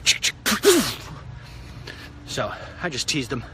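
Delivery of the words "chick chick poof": mock gun noises. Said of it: "they pull out and be like".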